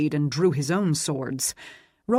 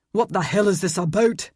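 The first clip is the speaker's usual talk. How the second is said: angry demand, higher and louder; 4 semitones higher, 4 dB louder than usual. A narrator's reading. Aidan demanding answers mid-fight, angry demand. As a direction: hurl the question at him. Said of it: "he demanded".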